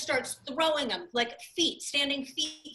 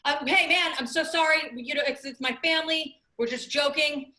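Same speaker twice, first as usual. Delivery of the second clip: higher, louder, pleading apology; imitated placating dad; 5 semitones higher, 5 dB louder than usual. Dad to man with a bat, imitated placating plea. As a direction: talk the angry man down, as dad. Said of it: "my dad puts his hands up".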